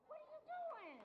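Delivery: squealed up high; amazed. Real, not acted. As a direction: gush in disbelief. Teasing amazement at youthful looks.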